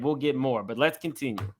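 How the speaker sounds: ordinary talk, nothing marked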